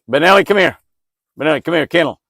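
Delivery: very loud, high urgent yell; shouting for dog. Real, not acted. Owner yells his dog to come and kennel, urgent shout. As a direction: holler the dog back right now.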